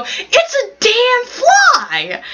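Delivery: put-on high, drawn-out gleeful kid; hammed-up kid thrilled, stretching out every word slowly; over-the-top enthusiasm. Little kid proudly answering an old lady, hammed-up enthusiasm. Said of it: "With the most, like, enthusiasm on damn fly".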